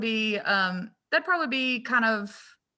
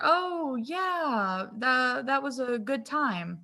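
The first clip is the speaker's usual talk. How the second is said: friendly professional; 3 semitones higher, much slower than usual. Pretend.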